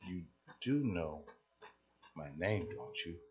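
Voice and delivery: hushed, probing question with pauses. Retold or imitated. Young lady asks if he knows her name, very quiet. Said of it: "voice got a little bit more quiet".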